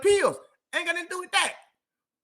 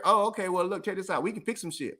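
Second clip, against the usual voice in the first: ordinary talk, nothing marked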